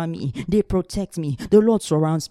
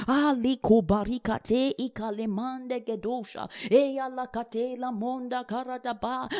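soft murmured tongues, higher; praying in tongues; 6 semitones higher, 6 dB quieter than usual. Real, not acted. Preacher praying in tongues mid-confession, hushed. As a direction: slip into tongues under the breath.

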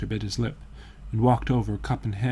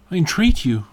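higher, louder urgent entreaty; earnest plea, pitched up; pleading; 7 semitones higher, 7 dB louder than usual. A narrator's reading. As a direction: implore them with a raised, insistent voice. Painter pleads with Dorian to stay.

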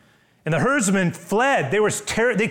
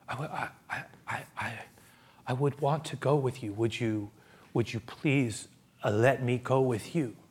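fearful stammering plea; 4 semitones lower, 8 dB quieter than usual. Acted. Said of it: "Worried that the deliverance might not stick".

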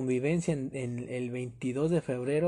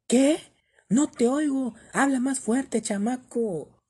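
shouted much higher and louder, mocking the staff girl; rude shout; 8 semitones higher, 5 dB louder, slower than usual. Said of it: "me gritó en mi cara". (grito grosero, mucho más agudo y fuerte)

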